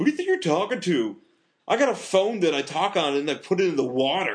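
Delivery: high-pitched, indignant movie quote; Rip. Retold or imitated. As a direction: act out an affronted drug dealer. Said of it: "he's like".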